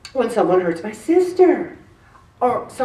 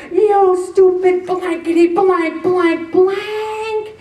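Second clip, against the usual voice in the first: high, loud, drawn-out angry yell; mock road rage, stretching every word; road-rage outburst; 8 semitones higher, 3 dB louder, much slower than usual. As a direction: yell it at a driver who cut you off. Acting out road rage at driver.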